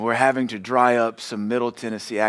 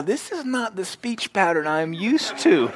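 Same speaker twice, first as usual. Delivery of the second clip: put-on child's voice; 8 semitones higher, slower than usual. Voicing child's thought, laughing.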